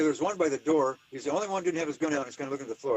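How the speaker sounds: light imitated Irish accent, fast at the end; mock Irish man describing the cop. The Irish man describing the city cop, in a light Irish accent. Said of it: "I'm gonna stop doing my Irish".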